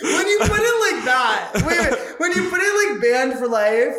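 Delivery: real laughing disbelief; laughing realization. Real, not acted. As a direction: crack up as it sinks in. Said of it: "laughing at the realization".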